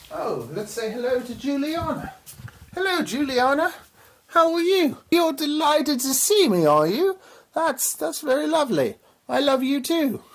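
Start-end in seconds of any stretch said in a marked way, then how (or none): 2.7-10.2 excited pet talk. Real, not acted. Greeting pet snake Juliana.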